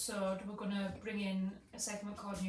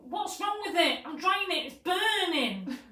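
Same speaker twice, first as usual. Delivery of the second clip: high, loud mock-panicked complaint; acted panic; 8 semitones higher, 10 dB louder, slower than usual. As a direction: imitate someone yelling in alarm, stretching the last word. Retold panic over her hair.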